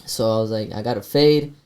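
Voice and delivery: ordinary talk, nothing marked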